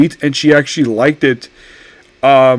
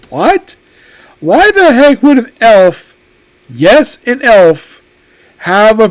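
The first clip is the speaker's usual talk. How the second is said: incredulous; 5 semitones higher, slower than usual. Retold or imitated. Stunned reaction to an elf's accent.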